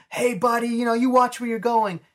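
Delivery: high, sly mocking Joker voice. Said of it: "he's like".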